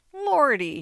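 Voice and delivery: high, loud wounded cry; hurt exclamation. Acted. Muzhik stung by the mistress's words, loud hurt cry. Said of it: "he exclaimed in hurt tones".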